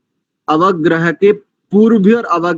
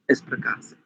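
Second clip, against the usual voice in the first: whispered aside; 3 semitones lower, 12 dB quieter, faster than usual. Real, not acted. Teacher aside after chanting.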